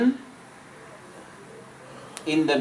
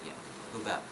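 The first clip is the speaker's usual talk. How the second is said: usual speaking voice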